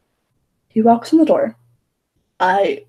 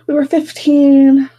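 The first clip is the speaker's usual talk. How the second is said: loud, higher, long drawn-out "fifteen"; emphatic exclamation; 5 semitones higher, 8 dB louder, slower than usual. Real, not acted. Vlogger stressing to viewers how young they were, loud exclamation. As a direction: call it out slowly, stretching the last word.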